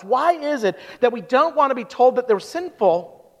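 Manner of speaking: normal talking voice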